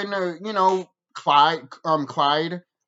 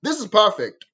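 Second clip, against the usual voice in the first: imagined delight; 13 semitones higher than usual. Retold or imitated. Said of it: "I would have been like".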